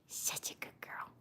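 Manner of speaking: soft whisper to the dog; whispered praise for the dog. Real, not acted. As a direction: breathe it to her gently.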